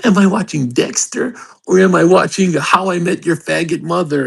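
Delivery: high put-on voice for a dithering viewer; mocking whiny viewer. Streamer mocking fans who fuss over TV shows, high and sneering. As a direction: sneer out a silly impression of a fan. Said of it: "some of you guys go".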